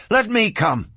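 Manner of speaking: high-pitched, urgent plea. A narrator's reading. Boy begging the sheriff to take him along, urgent. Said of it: "Lon said urgently".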